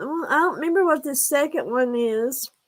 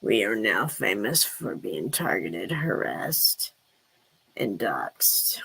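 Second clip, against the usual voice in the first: deep pitched-down character voice; Jody Sue voice; 11 semitones lower, 4 dB quieter than usual. Acted. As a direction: lower and softer, a filtered persona. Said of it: "I'm Jody Sue".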